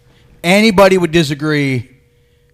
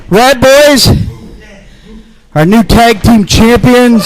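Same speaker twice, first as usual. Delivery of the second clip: loud, slow ring-announcer call; announcer shout; 3 semitones higher, 4 dB louder, slower than usual. Acted. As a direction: boom it out to the crowd. Announcing champions again.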